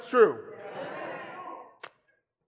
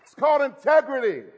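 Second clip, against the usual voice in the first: preaching; 6 semitones higher than usual. Real, not acted. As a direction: drive the point home from the pulpit. Preaching at full force.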